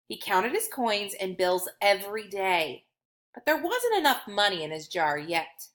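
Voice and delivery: plain reading voice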